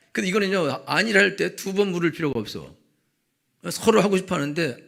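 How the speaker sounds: 목소리를 높여 힘주어. Real, not acted. Heated argument about asking twice. (크게 힘주어 말하기)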